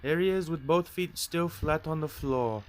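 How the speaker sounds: Glenn voice. Acted. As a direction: put on an upbeat reviewer voice. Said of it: "I apologize for the cringe voice acting".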